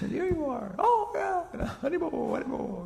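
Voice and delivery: very high, crying with joy; the queens greeting their lost friend in high, tearful joy. Retold or imitated. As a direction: sob out a happy reunion. Joyful reunion of the queens.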